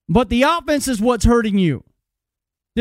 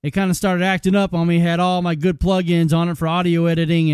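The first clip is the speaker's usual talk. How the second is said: normal talking voice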